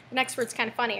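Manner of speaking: ordinary talk, nothing marked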